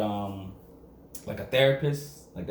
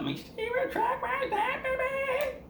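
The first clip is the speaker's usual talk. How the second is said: playful put-on voice; slower than usual. Playful aside with laugh.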